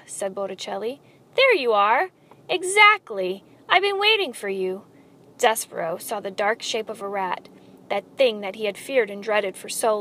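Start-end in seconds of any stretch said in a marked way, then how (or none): 1.4-4.9 high, effusive welcome, louder at first; long-lost-friend greeting. A narrator's reading. Rat hails the mouse he awaited, with effusive familiarity. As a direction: greet him like an old friend. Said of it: "called out to him as if he were a long-lost friend".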